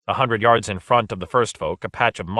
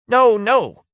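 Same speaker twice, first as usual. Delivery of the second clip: much higher, loud pleading no; urgent protest; 7 dB louder than usual. A narrator's reading. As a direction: protest in alarm. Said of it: "protested Jambart".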